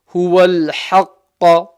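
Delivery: HUWAL HAQQA recited slow and loud, throaty ha; mid-throat ha. Shown as a demonstration. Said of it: "second ha is from the middle of the throat".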